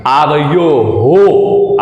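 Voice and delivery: आवयो हो drawn out very slowly, ओ carried into हो; slow, careful recitation of आवयो हो; slow teaching demo. Shown as a demonstration. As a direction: spell out आवयो हो syllable by syllable for students. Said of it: "यहाँ विसर्ग के अंदर भी ओ स्वर आना चाहिए".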